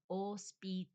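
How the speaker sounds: hospital as os, pee, TAL, silent H; slow Spanish hospital. Shown as a demonstration. Showing the open mouth, hospital as slow os-pee-TAL. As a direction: break up hospital, opening wide on TAL. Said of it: "see how the mouth".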